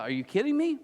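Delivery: incredulous outburst, voiced for a whole crowd; exasperated disbelief. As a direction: pitch jumping up in disbelief.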